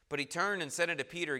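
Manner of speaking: ordinary talk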